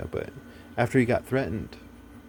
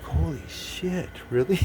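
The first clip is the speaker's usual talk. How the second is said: stunned disbelief; 6 semitones higher than usual. Retold or imitated. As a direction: replay the moment the news floored him. His stunned reaction retold.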